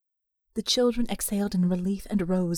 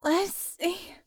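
groaning; 6 semitones higher than usual. Acted. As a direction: moan the name as she shivers. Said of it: "she groaned".

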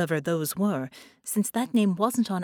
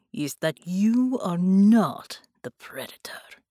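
slow, intense, louder whisper; menacing whisper; 5 dB louder, slower than usual. Acted. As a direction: press the threat home. Bela threat while choking her.

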